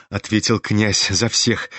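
ordinary delivery

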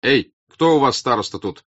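shout. A narrator's reading. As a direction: call out sharply to a crowd. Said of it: "крикнул Ростов".

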